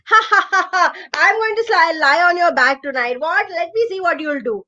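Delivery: mock the crocodile; teasing toad. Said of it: "So the frog said".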